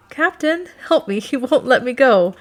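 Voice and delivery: high, loud playful cry for help; laughing plea. A narrator's reading. Woman playfully tugged away by the Duke, laughing plea to captain. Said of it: "I laughed".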